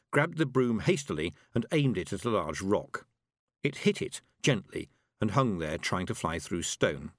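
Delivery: ordinary delivery, nothing marked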